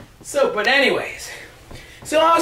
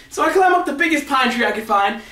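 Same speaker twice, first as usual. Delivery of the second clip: ordinary talk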